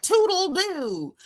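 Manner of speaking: toodle doo sung out high and drawn out; mocking toodle doo. Host mocking her castmate's slip, high drawn-out toodle doo. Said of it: "the way she was saying".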